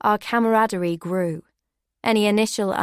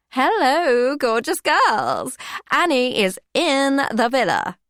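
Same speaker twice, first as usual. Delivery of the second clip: higher, louder, slow hammed-up entrance; pantomime diva; 5 semitones higher, 5 dB louder, slower than usual. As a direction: play it big for the cameras. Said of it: "a pantomime performance for the cameras".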